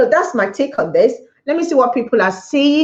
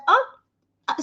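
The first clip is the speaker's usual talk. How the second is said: a lightly voiced, much higher puzzled huh; confused huh; 12 semitones higher, 4 dB quieter than usual. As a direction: sound baffled by the question. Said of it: "he was like".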